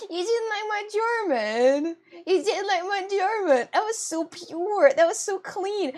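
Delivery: high, drawn-out indignant protest; mock hurt. Streamer defending her German to chat, mock hurt. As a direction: plead in wounded, hammed-up disbelief.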